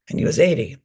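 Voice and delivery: ordinary talk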